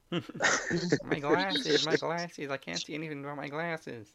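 a light spoof of Velma hunting her glasses; Velma impression. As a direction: fumble about like a lost Velma. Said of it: "Velma from fucking".

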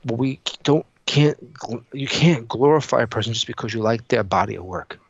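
ordinary talk, nothing marked

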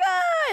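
high squeal of relief; joyful cry. Retold or imitated. Dorothy spotting Toto, a high squeal of joy. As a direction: let out a tiny yelp of joy. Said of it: "she's like".

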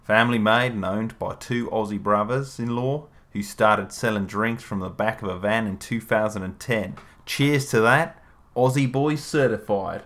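put-on Australian accent reading a label. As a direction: read it out like an Aussie. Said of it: "if that was a good accent or not".

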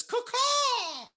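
shrill, very high squawking bird voice; chirping bird person. Acted. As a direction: screech it out like a frantic cartoon bird. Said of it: "this bird flies up in your house and then says".

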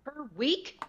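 incredulous question; disbelieving "per week?"; surprised. Real, not acted. Player surprised at price.

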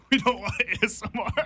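laughing through the words. Real, not acted. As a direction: laugh while you say it. Laughing at ASMR joke.

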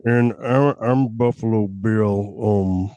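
Buffalo Bill voice. Acted. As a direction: play a strange doctor as a movie creep. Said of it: "Are you gonna talk like that".

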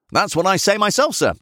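high-pitched, eager servant voice. A narrator's reading. Servant William chimes in to agree with his master, eagerly.

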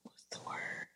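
whispered aside, searching for a word. Real, not acted. Whispering to herself while hunting for the word. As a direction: breathe it under your breath.

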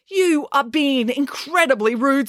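slow, high-pitched telling-off; indignant teacher scolding a pupil. Acted. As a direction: stretch out the reprimand.

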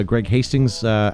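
usual speaking voice

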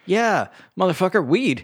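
high, loud mock incredulity; hammed-up outraged comeback; exasperated retort. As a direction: blurt it out at the obvious, pitch way up. Mocking retort to stoned man's question.

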